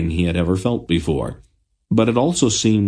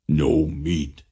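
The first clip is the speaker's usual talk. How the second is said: grumbling; 4 semitones lower, 3 dB quieter than usual. A narrator's reading. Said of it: "he grumbled".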